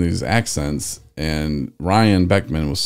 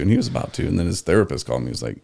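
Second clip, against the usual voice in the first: ordinary talk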